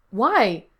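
indignant outburst; demanding. A narrator's reading. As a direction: challenge them sharply. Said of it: "he demanded".

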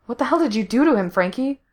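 fast, high-pitched incredulous question; astonished demand. A narrator's reading. Rescued man questions his rescuer, astonished. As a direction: blurt it out quickly, pitch up.

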